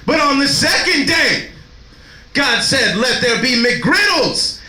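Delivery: high shouted slow preaching, half sung; mock preacher. Hype man preaching McGriddles to the crowd, shouting. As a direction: build the sermon to a roar. Mock Genesis sermon peak.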